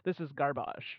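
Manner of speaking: playful French accent, dropping in pitch. Shown as a demonstration. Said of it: "utiliser mon accent français".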